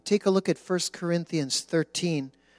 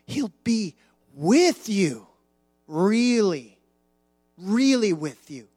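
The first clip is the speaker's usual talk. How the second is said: gently insist, really, really; insisting heavenly voice; 3 semitones higher, 5 dB louder than usual. Acted. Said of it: "And the voice going".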